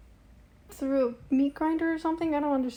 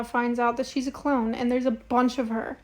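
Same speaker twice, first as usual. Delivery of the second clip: ordinary talk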